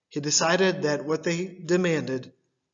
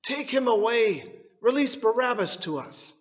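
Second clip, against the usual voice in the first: crowd shouting; 7 semitones higher than usual. Acted. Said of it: "They all shouted together with one voice".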